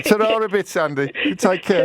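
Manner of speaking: put-on high, amused touch of a Birmingham accent; playful Brummie sign-off. Host borrows caller's farewell to sign off, playful Brummie. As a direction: lightly put on Brummie for a fond goodbye.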